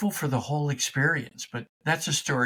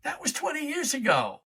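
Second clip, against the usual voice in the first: sudden realization; 7 semitones higher than usual. Acted. Self-talk on waking up to the lie, surprised realization. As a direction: catch yourself mid-thought, wide-eyed. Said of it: "wake up one day and say".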